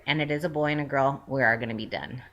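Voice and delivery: ordinary talk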